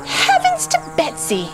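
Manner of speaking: put-on very high, old-fashioned waitress exclaiming; exclaimed. A narrator's reading. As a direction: gasp it out like a startled waitress. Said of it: "she exclaimed".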